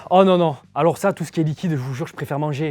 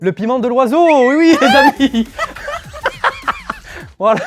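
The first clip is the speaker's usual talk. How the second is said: laughing; 13 semitones higher, 10 dB louder than usual. Real, not acted. Laughing fit after the spicy tea.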